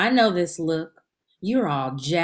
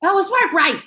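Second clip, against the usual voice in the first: high, loud gleeful call; excited; 11 semitones higher, 7 dB louder than usual. Acted. Woman cheering a hot match on the app, loud and gleeful. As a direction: squeal it out with glee. Eager dating app verdict.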